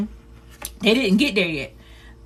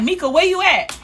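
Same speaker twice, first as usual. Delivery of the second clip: higher, calling down the hallway; grandma calling out; 9 semitones higher than usual. Retold or imitated. Grandma calling for Mika from the hallway, high call. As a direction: call out for the kid, high and searching. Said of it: "And she was like".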